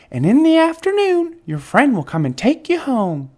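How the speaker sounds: high, bright nurse announcing. A narrator's reading. Nurse voiced.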